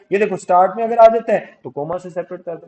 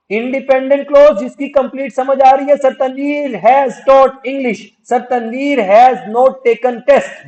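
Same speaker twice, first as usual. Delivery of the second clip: raised, high-pitched lecturing voice; emphatic drilling; 7 semitones higher, 7 dB louder than usual. Shown as a demonstration. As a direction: hammer out the example for the class. Teacher drills the example loudly.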